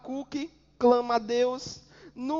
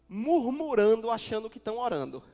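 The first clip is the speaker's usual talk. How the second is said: ordinary delivery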